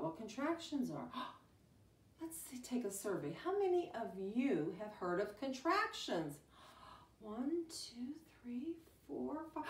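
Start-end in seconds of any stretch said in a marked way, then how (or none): 1.1-2.6 whispered gasp. Pretend. Teacher starts a survey. 7.2-9.6 whispered counting. Acted. Teacher counts the children's raised hands in a whisper.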